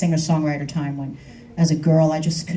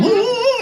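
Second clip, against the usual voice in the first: wolf howl. Retold or imitated. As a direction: howl up high like calling a wolf. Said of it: "And I go".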